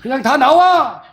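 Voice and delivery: a slow, drawn-out mock preacher call; mocking faith healer. Mocking odd ministers who knock people down, hammed-up call. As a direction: lampoon a showy healer. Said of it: "이상한 사역자들".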